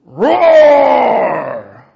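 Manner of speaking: loud high roar; Satan's lion roar. Acted. Preacher voicing Satan, roaring loud like a lion. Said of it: "And Satan goes".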